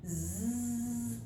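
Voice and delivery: soft buzzing zzz; quiet insect buzz; mosquito buzz. Acted.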